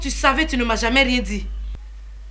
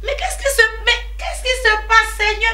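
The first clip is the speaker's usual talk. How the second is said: distraught; 10 semitones higher than usual. Acted. Distraught after husband's call.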